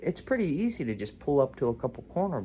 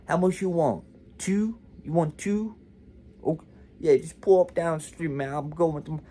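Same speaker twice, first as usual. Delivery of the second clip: higher-pitched imitated Baltimore accent; 6 semitones higher, 4 dB louder than usual. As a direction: play a Baltimore street kid, accent and raised pitch. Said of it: "the Baltimore accent".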